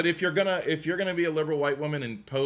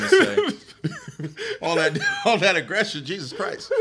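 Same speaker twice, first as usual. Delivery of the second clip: high, loud laughing through the words; laughing at a friend's outburst; 7 semitones higher, 7 dB louder, slower than usual. Real, not acted. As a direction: crack up while teasing your friend. Teasing friend after rant.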